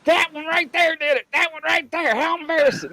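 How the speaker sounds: imitating the girl in the back seat. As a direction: point him out like a tattletale. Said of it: "the girl in the back seat was like".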